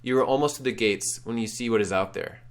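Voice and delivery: usual speaking voice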